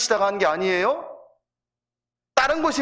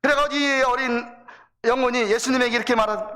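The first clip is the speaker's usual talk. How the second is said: ordinary talk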